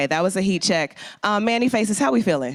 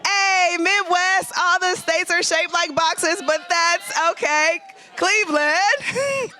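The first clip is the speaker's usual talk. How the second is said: excited shout-out; 12 semitones higher, slower than usual. Real, not acted. Host shouting out Midwest attendees, excited. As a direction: lift the room up. Hyping regions in the crowd.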